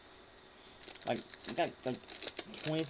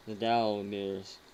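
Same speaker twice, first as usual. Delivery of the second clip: slowly savoring the pun; slow drawn out; 2 semitones lower, 5 dB louder, much slower than usual. Real, not acted. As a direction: stretch each word out slowly. Drawing out a made-up pun.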